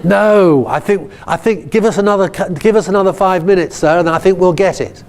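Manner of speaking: lightly voiced class begging, high and urgent; pupils protesting. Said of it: "And they go".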